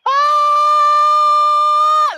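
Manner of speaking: loud high wake-up yell; long loud high shout. Shown as a demonstration. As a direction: yell it out to stay awake at the wheel. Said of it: "I have to shout".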